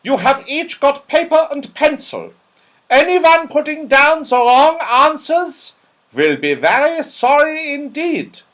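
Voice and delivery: put-on high, loud scolding old dame; Dame Snap, sharp. A narrator's reading. Stern old dame scolding a scruffy pupil, loud and high. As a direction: bark it like a cross schoolmistress. Stern teacher threatens the class.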